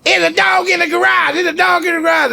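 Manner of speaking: high excited shouting about the dog; kid's alarmed shout. Retold or imitated. Son runs into the office shouting about a dog, high alarmed shout. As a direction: burst in yelling the news.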